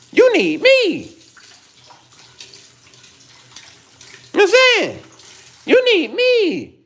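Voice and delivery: high-pitched, loud preachy rant; heated scolding. Real, not acted. Vlogger scolding angry women for lacking Jesus, heated and high-pitched.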